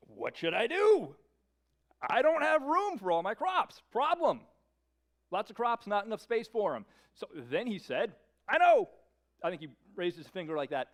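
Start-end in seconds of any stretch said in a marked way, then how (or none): 0.1-6.9 high, loud fretting rich farmer; a rich farmer fretting aloud about his overflowing barns; rich fool talking to himself. Acted. Voicing the rich fool's inner monologue. 8.5-8.9 high, loud sudden idea; the rich man hitting on his plan, excited; eureka moment. Acted.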